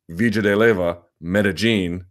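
Villa and Medellin with LL as J; local city pronunciation. Shown as a demonstration. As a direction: say "VEE-ja de Leyva, Meh-deh-JEEN". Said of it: "the way that they pronounce their cities".

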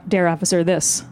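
plain reading voice

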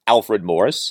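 ordinary talk, nothing marked

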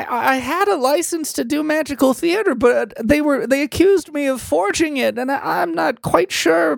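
high fairy voice. Acted. GM redoing the fairy's line in the right high voice. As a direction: redo it high and sighing.

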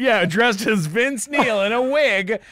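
loud, high rant peaking in a drawn-out wail; hammed-up incredulous outburst; exasperated disbelief.

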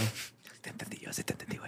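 hushed, voiceless aside; whispered aside. Real, not acted. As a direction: whisper it privately to your co-host. Aside to co-host during the show.

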